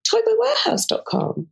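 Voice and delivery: high squeaky imitation of auntie; imitating auntie. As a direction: pitch it way up like a giddy auntie. Auntie's quoted suggestion.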